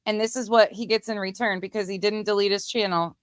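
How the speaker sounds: ordinary talk, nothing marked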